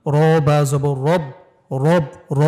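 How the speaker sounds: র said full and thick, রব slow and heavy. Shown as a demonstration. Teacher asks students to copy the heavy র. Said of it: "একটু মোটা করে উচ্চারণ হচ্ছে".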